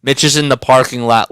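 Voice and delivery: ordinary delivery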